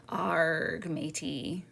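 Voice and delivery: a movie pirate growl with the R stressed; pirate accent. Shown as a demonstration. Educator showing the Robert Newton pirate cliché, playful pirate accent. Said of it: "people have imagined pirates saying things like".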